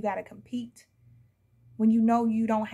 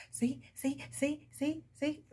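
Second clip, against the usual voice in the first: higher, softer sing-song mocking; childish taunt; 4 semitones higher, 6 dB quieter, slower than usual. Mimicking the co-parent's childish soft taunting. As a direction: tease like a petty child. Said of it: "This is a childish person".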